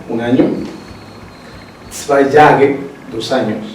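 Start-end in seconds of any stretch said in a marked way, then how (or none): none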